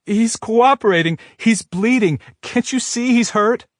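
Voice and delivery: put-on high, loud, desperate young woman; desperate pleading. A narrator's reading. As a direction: beg the cops to stop.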